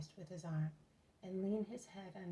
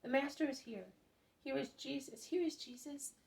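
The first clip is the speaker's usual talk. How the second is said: high, lightly voiced children's cries; storyteller's mock shout of excited children; 7 semitones higher than usual. A narrator's reading. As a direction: call it out high like excited children, without real volume. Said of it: "round them shouting".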